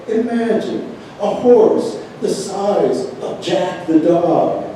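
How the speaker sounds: storytelling verse in careful, separated phrases; poem reading. Acted. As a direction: recite the anecdote as a poem to an audience. Reads own poem aloud.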